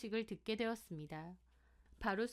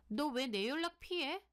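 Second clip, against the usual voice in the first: accusing; 5 semitones higher than usual. Acted. Friend confronts narrator avoiding her.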